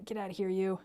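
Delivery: usual speaking voice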